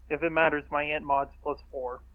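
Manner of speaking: ordinary talk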